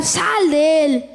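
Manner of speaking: slow, firm command to the demon; a hint of an exorcist's stern order; commanding with authority. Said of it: "sino Yahshua con autoridad".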